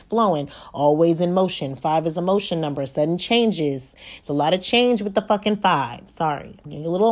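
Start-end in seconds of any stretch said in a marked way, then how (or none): none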